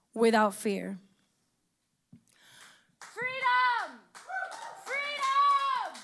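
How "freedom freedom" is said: high, drawn-out chant of freedom; high-pitched distant-sounding shout; imitated protest scream. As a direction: cry it out like a protester in a crowd. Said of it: "what I heard from the people screaming".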